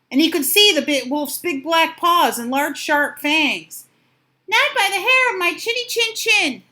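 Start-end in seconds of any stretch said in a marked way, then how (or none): 4.5-6.6 high loud little pig; high defiant pig voice. Acted. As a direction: refuse in a squeaky pig voice. Pig defies wolf.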